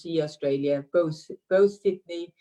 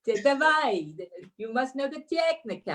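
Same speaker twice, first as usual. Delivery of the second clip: higher-pitched mock Russian accent, settling at the end; 8 semitones higher than usual. Shown as a demonstration. Guest performs for host on request, imitated Russian accent. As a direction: put on a Russian or Ukrainian accent. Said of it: "in a Russian or Ukrainian accent".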